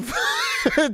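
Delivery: very high squealing laugh; shrieking laugh. Real, not acted. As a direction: squeal helplessly. Cracks up at penis gingerly.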